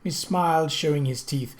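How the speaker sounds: ordinary delivery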